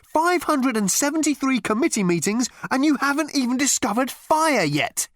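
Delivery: high, exasperated protest; exclaiming. A narrator's reading. Ford berating the committee over fire, exclaiming. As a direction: reproach them in disbelief. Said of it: "he exclaimed".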